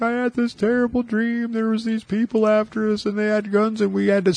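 a hint of a shaken wife telling her nightmare; wife's voice. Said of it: "And she's like".

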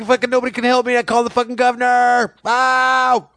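very high, strained mock holler; brother impression. Brother complaining nobody can help, high imitated yelling. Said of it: "he talk like this".

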